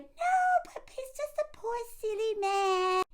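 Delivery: high breathy mock of a doting friend; mocking her defence. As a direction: mimic her fawning over him. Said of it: "And then she's like".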